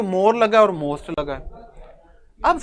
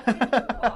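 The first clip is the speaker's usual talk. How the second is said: short chuckle at his own silly demo; quiet burst of amused laughter; 7 dB quieter than usual. Real, not acted. Teacher laughing after breaking a word wrongly, amused chuckle.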